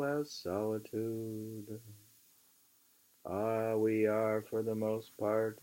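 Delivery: measured, emphatic book reading; reading aloud. Acted. Reading Thoreau aloud to a friend, measured and emphatic. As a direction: read the old essay aloud with weight. Reads on at length.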